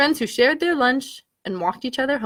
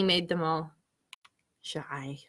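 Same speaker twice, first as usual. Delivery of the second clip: shy whisper; 8 semitones lower, 10 dB quieter, slower than usual. A narrator's reading. Storyteller whispering to children, shy hush. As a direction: whisper the word, suddenly bashful. Narration turns all shy.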